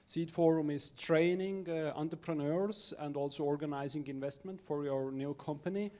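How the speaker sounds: ordinary talk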